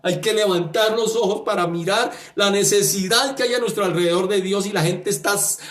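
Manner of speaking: ordinary talk, nothing marked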